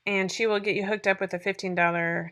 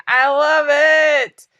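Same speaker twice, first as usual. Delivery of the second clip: gushing love; 6 semitones higher, 12 dB louder, much slower than usual. Real, not acted. Gushing about the course platform.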